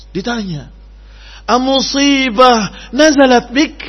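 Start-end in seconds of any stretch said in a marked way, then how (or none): 1.5-3.8 slow, loud, higher recited question; Arabic quotation. Acted.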